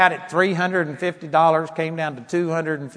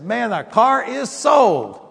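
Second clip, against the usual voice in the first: slow, higher, emphatic announcement; retold excitement; 5 semitones higher, 3 dB louder, slower than usual. Husband telling his wife about the car, excited. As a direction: proclaim the good news, stretched out. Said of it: "And I got so excited".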